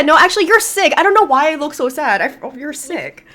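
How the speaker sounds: ordinary talk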